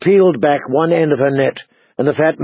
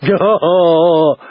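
drawn-out, owl-like hoot; slow hooting call; Hodor hooting; 2 semitones higher than usual. A narrator's reading. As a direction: hoot the word in two long syllables.

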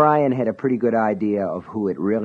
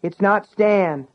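put-on high, slow child's call; called back; 10 semitones higher, slower than usual. Sean calls back up to Brian, high and drawn out. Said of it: "Sean called back".

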